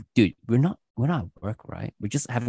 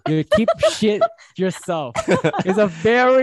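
laughing; 8 semitones higher, 5 dB louder, slower than usual. Real, not acted. Laughing while teasing.

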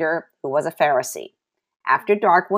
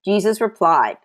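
usual speaking voice

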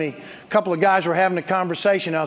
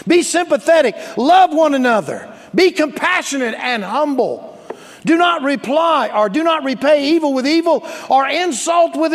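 high, loud preacher's proclamation; full-force scripture reading; 8 semitones higher, 7 dB louder than usual. Acted. Pastor reading First Peter to the church, loud preaching. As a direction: drive each command home loudly.

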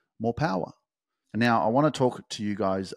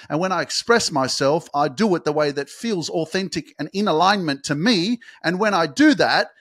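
much higher and louder, full-force sermon; fired-up preaching; 8 semitones higher, 7 dB louder than usual. Real, not acted. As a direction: preach it like you're on a stage. Impassioned preaching peak.

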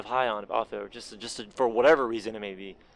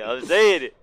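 louder insisting repeat; 8 dB louder, slower than usual. Shown as a demonstration. Insisting louder to friends mocking his word. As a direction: repeat it louder, pressing the point.

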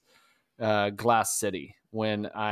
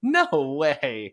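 retold disbelief; 3 semitones higher, 4 dB louder than usual. Retold reaction to record.

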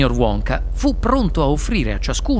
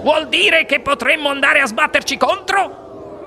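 high-pitched, aghast outburst; alarmed question; 7 semitones higher than usual. Acted. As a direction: blurt it out dreading a crash. Man fears a head-on crash.

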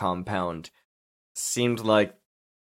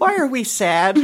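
put-on much higher child voice, breaking into laughter; mock a puzzled little kid, pitched way up; imitating a kid; 12 semitones higher, 4 dB louder than usual. Host imitating the kid in the movie, childlike. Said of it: "the kid just stops and goes".

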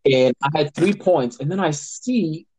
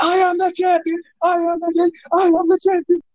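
much higher, crowing victory shout; triumphant shout. Retold or imitated. Husband jumps up in front of his wife after the winning touchdown, high triumphant shout. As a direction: crow it like you just won the title. Celebrating a last-minute fantasy win.

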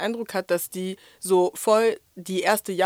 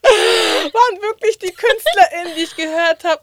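laughing; 10 semitones higher, 9 dB louder than usual. Real, not acted. Laughing at her own list.

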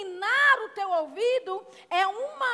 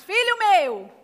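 louder repeat; 6 dB louder, slower than usual. Shown as a demonstration. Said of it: "vocês estão bem treinados".

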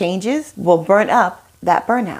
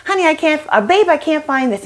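higher mock helpless husband calling for help; a light, raised-voice impression of the helpless husband; mock husband whining; 6 semitones higher than usual. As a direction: play the husband who can't find anything.

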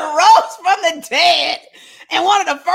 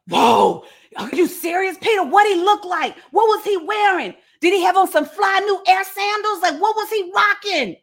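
higher, eager rapid-fire questions, a few near shouts; excited questioning; 5 semitones higher than usual. Acted. Disciples grilling Peter about what Jesus looked like, all excited. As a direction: pepper him with nosy questions.

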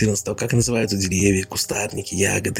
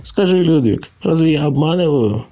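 higher, louder mock-offended protest; indignant liar; 8 semitones higher, 4 dB louder than usual. Laban protests to the bunnies, higher and louder mock offense. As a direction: act wounded that they'd doubt you.

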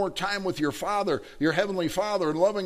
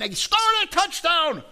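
high, loud celebrating shout; acted-out fan's cheer; excited touchdown cheer; 6 dB louder than usual. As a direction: yell it out like the winning score. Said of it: "you get so excited".

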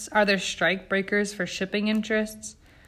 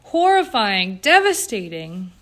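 loud, drawn-out indignation, pitch falling word by word; sputtering outrage; 4 semitones higher, 8 dB louder than usual. A narrator's reading. Jane outraged at Eleanor's mild reply, sputtering outrage. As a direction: hit each adjective hard, starting high. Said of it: "Jane sputtered".

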